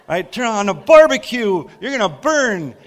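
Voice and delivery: high, loud, hammed-up hellfire sermon; mock fire-and-brimstone preaching; brimstone preacher. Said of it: "brimstone kind of one".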